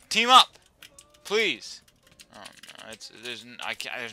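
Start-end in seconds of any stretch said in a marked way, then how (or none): 0.1-1.8 high, loud plea; pleading shout. Real, not acted. Gamer begging other players to team, pleading shout. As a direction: call out urgently for help.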